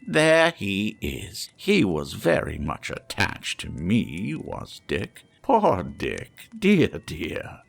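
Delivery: put-on recognise an old friend with joy; fond Scrooge. Scrooge tells the ghost about Dick, fondly delighted.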